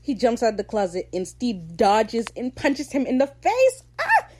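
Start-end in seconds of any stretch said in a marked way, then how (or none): 4.0-4.3 high, sudden shriek of delight; excited squeal. Real, not acted. Recapper thrilled by the punch, excited squeal. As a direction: squeal over the big moment.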